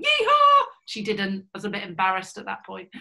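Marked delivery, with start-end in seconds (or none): 0.0-0.6 a big, high whooping yeehaw, much louder; yeehaw yell. Retold or imitated. Pilot whooping through the loop with her cadet, loud joyful yell. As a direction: let rip with a triumphant whoop. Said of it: "I went".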